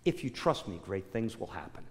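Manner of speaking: soft, low promise; quiet, assured voice in the heart; inner voice of God. Acted. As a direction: say it hushed, falling away at the end. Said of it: "I heard this voice say in my heart".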